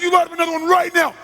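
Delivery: high, very loud demand; crazed commanding shout; screamed order. Retold or imitated. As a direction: scream it relentlessly.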